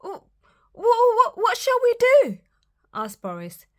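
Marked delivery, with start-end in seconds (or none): 0.0-2.4 very high, loud, stuttering squeal; stammering panic. Acted. Piglets panic at wolf.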